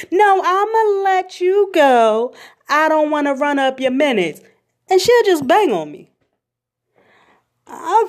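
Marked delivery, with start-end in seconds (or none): 0.1-4.4 grandma refusing to keep talking; insistent grandma. Retold or imitated. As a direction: draw it out to shut the talk down. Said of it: "So how is".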